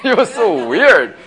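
laughs through the words; laughing. Real, not acted. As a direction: breaks into a laugh, then gets it out. Laughing at his own story.

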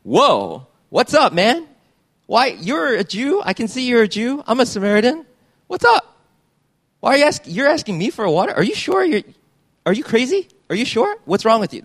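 high, astonished disbelief; incredulous Samaritan woman. Retold or imitated. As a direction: Samaritan woman stunned at Jesus asking her for water. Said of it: "but she's actually saying".

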